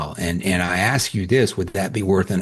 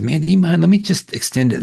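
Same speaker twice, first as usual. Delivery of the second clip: higher, louder, keen pitch; eager suggestion; 8 semitones higher, 4 dB louder than usual. Retold or imitated. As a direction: sell the idea with a lift in the voice. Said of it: "I said".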